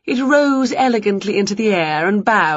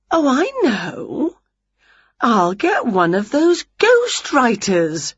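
bright idea witch; 5 semitones higher, slower than usual. A narrator's reading.

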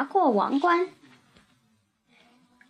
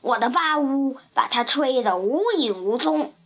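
ordinary delivery, nothing marked

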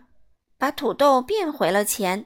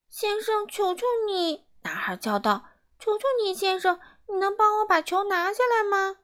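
put-on much higher child's begging voice; pleading little boy; 10 semitones higher than usual. Boy begging the miser for his ball, high pleading.

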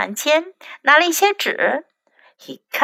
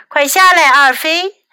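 louder alarmed call; 4 semitones higher, 5 dB louder than usual. Acted. Mother calls Effie down from the tree, louder. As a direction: shout him down from the tree. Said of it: "妈妈叫道".

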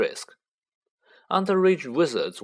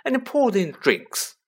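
high prim housewife voice; lightly voiced fussy aunt; Aunt Petunia voice; 9 semitones higher, 3 dB louder than usual. A narrator's reading. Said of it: "said Aunt Petunia".

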